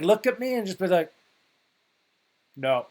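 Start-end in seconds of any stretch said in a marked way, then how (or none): 2.6-2.9 deadpan, one short no; flat refusal. Retold or imitated. Professor answering his clueless roommate, deadpan. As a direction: shut it down without any feeling. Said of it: "just sort of flatly".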